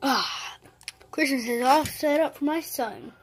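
usual speaking voice

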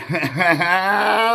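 loud laugh at the memory of the trip; big high laugh. Real, not acted. Laughing after the punchline.

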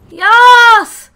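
high, loud shout of relief; triumphant yell. Real, not acted. Gamer after escaping, yelling in triumph. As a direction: cheer it out.

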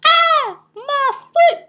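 very high, loud hammed-up yelp of pain; mock wail of a man shot in the foot; pained cry. Shot guy crying out about his foot, high pained wail. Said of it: "They was like".

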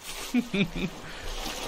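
high breathy giggle; quick amused laugh. Real, not acted. Laughing at own voice.

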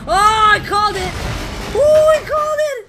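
very high, loud gloating shriek; loud, high, excited boasting; triumphant squeal. Real, not acted. Exulting at a correct prediction.

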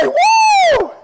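high, long "woo"; loud falsetto-high cheer; whoop. Shown as a demonstration. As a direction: let out a soaring revival whoop.

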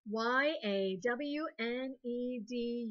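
slow, letter by letter; spelling aloud. Shown as a demonstration. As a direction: clap out each letter slowly. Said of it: "clap a syllable".

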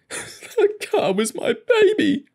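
high, slow wailing sob; sobbing. A narrator's reading. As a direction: blubber it like a heartbroken child. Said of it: "he sobbed".

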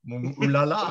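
knowing French ooh la la; a lightly voiced knowing Frenchman. The Frenchman, grasping the joke, lightly voiced knowing French exclamation. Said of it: "being French, he ... went".

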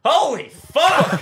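high, loud, drawn-out cry of disbelief; shocked shout. Retold or imitated. Bystander eating a hot dog seeing the plane, shocked yell. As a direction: gasp it out, stunned, slowly. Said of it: "you just look at it like".

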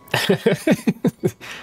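high breathy villain cackle; evil cackle. Acted. Villain laugh at ambush.

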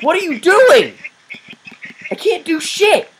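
real frustrated rant; exasperated yelling. Real, not acted. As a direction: complain loudly at the game.

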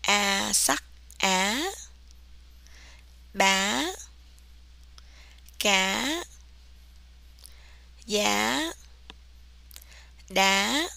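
"a, ba, ga, ya, da" each held, then gliding sharply upward; rising tone. Shown as a demonstration. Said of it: "You start high and you go higher".

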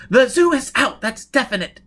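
loud, high, heated veto; adamant refusal. Acted. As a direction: shut the idea down angrily. Jiz flatly forbids the zoo.

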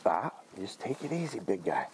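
soft, low calming words; soothing. Real, not acted. Trainer calming his excited dog, soft and low. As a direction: settle the dog down gently.

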